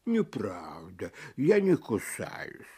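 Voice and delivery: low, soft, slow tiger; gentle tiger voice. Acted. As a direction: softly protest like a kind beast. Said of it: "сказал тигр".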